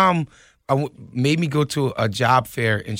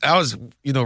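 ordinary delivery

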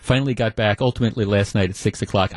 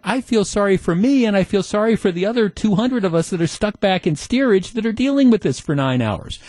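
higher, indignant complaint; exasperated rant; 7 semitones higher than usual. Retold or imitated. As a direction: vent about being stuck in steerage. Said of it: "And I'm like".

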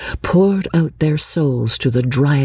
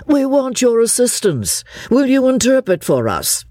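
high-pitched appeal for help; calling out; 9 semitones higher than usual. A narrator's reading. Mister Beebe asking Mister Eager to interpret, calling out. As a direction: call over for assistance, pitched up. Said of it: "called Mister Beebe".

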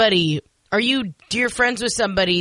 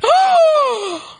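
shocked gasp; 6 dB louder than usual. Real, not acted. Shocked by the secret.

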